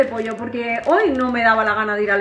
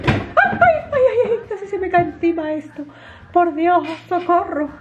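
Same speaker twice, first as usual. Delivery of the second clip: mock panic; 6 semitones higher than usual. Mock panic as fridge spills.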